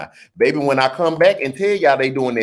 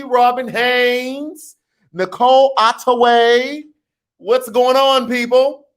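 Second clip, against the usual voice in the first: cheery shout-outs; 9 semitones higher, 3 dB louder than usual. Real, not acted. Greeting commenters by name.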